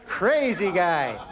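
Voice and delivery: slow, stretched "crazy guy" with a laugh; slow drawn-out punchline. Acted.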